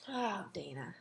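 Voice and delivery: ordinary talk, nothing marked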